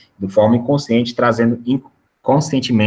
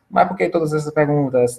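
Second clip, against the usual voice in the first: a lightly voiced suspicious client, pitched up; questioning client; 6 semitones higher than usual. Customer questioning the salesperson about all the questions, lightly voiced and pitched up. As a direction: ask it as a wary customer would, raised pitch. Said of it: "E se a pessoa lhe questionar".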